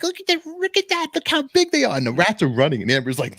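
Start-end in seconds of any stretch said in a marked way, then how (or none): 0.0-2.0 very high, louder squeal of wonder; high-pitched mock kid excitement; excited child. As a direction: imitate a kid thrilled by what she sees. Retold child thrilled by rats.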